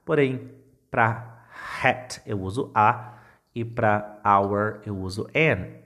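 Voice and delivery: ordinary talk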